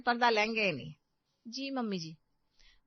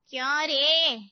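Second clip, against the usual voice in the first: put-on loud, slow scolding old woman's voice; gruff old aunt; 5 semitones higher, 8 dB louder, slower than usual. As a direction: bark it like a stern old aunt. (बूढ़ी मौसी की ऊँची, धीमी डाँट)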